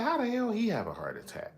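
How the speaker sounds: high, shocked question. Retold or imitated. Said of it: "So I'm like".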